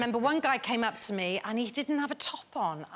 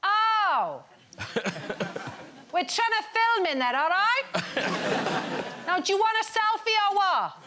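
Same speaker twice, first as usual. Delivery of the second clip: Nessa's voice; 9 semitones higher than usual. Retold or imitated. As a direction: snap at the noisy crowd outside. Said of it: "and just go".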